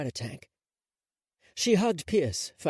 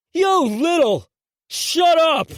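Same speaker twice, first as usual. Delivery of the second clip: high, loud enraged yell; loud shrieking shut-up; furious shout; 10 dB louder, slower than usual. Acted. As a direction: scream them into silence. Furious outburst at the mockers.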